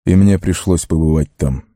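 usual speaking voice

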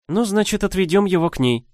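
put-on brisk, decisive young witch. A narrator's reading. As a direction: settle it quickly. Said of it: "ответила мнимая Кассимара". (решительная молодая ведьма)

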